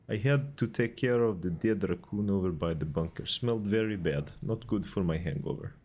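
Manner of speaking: light put-on Russian accent, playful. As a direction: voice Vlad with a hint of Russian. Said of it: "Just fun to do a Russian accent".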